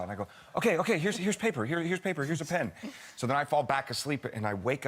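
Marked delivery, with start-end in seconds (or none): 0.5-2.7 higher-pitched, harried imitation of a dad; hurried placating. Sleepy dad hands daughter drawing things, hurried placating. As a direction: placate a child to get back to sleep.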